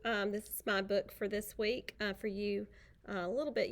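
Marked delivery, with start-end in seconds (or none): none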